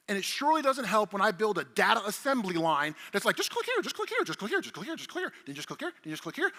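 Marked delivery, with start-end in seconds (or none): 3.4-6.5 rattled-off repetitive demo spiel, starting high; mock breezy patter. Presenter parodying his data assembly line, mock breezy patter.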